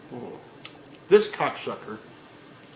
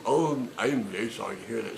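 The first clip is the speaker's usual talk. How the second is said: softer, syrupy scripted apology; mock agent sympathy; 3 semitones lower, 5 dB quieter than usual. Agent reciting sympathy to the angry caller, softly. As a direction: fake customer-service sympathy.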